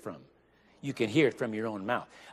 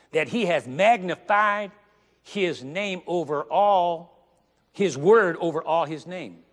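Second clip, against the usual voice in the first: slow emphatic; 4 semitones higher, 5 dB louder, slower than usual. Acted.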